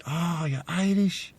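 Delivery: imitated soft, high, drawn-out amazement; delighted surprise. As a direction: react in wonder, high and hushed. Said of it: "their eyes lights up".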